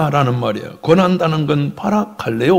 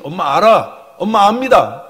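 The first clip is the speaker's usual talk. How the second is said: high, loud swelling assurance; impassioned mother; 7 semitones higher, 5 dB louder than usual. Acted. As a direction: cry out that you understand your child. (북받쳐 외치는 엄마)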